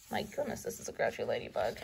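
soft low aside; quiet aside. Real, not acted. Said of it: "aside to listeners".

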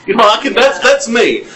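usual speaking voice

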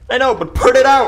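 high, loud, urgent yell; alarmed shout. Real, not acted. Gamer urging someone to put out the fire, alarmed shout.

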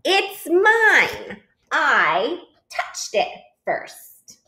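high burst, then slow, emphatic words; possessive claim. Acted. Goose claims the egg from Duck, high and insistent.

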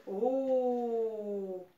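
"Oh" held long after a long f; drawn-out vowel. Shown as a demonstration. Teacher sounding out faux for pupils, long held vowel. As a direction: sustain a rounded "Oh". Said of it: "qui fait un rond dans la bouche".

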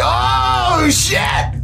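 high shouted "oh shit" in shock; excited shout. Real, not acted. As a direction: blurt it out thrilled. Reacting to fast movie kill.

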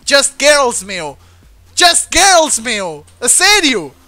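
loud, fed-up cry of the song name; exasperated shout. Real, not acted. Gamer annoyed the song is stuck in his head, shouting. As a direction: yell it like it's driving you mad.

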